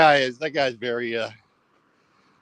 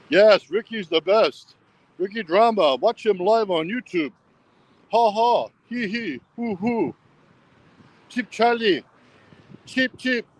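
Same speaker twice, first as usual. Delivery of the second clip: put-on higher, slow teasing girl's voice; sing-song mockery from a pretend girl; 4 semitones higher than usual. Vlogger voicing a girl teasing him as cheap, high mocking.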